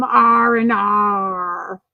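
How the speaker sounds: slow, stretched pirate arr; pirate growl. Acted. Streamer cheering on pirate puns, drawn-out pirate arr. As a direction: drag out the R's like a pirate.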